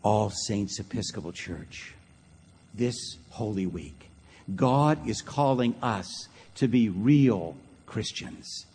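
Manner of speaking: very slow, low, hushed appeal; solemn preaching. Real, not acted. Preacher calling All Saints church to real Christianity, slow, low and solemn. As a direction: let each phrase land gravely, softly and slowly.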